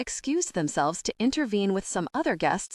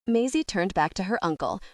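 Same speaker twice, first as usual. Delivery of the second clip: plain reading voice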